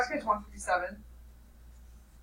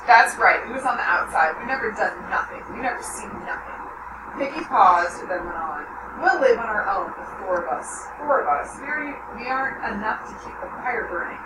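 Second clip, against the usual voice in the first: steady read-aloud voice for the class; story narration; 5 dB louder than usual. A narrator's reading. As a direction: read the novel to the students. Teacher reads novel aloud.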